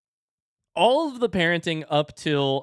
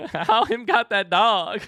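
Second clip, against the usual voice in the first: put-on giggling, slow, higher toddler line; laughing toddler imitation; 8 semitones higher, 6 dB louder, slower than usual. Parent laughing as he repeats his toddler's words. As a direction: repeat it high through laughter. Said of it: "My two-year-old just said".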